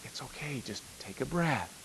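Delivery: put-on soft, hushed reassurance to a panicked kid; calm him down gently; quiet, kind policeman easing a boy's panic; soothing cop voice. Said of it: "He's like".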